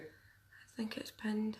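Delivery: soft unvoiced whisper. Real, not acted. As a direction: whisper it to yourself. Aside while checking pinned comment.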